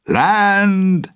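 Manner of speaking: high, loud call from the lookout; sailor's shout. A narrator's reading. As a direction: yell it out across the deck. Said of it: "A sailor's voice stopped him".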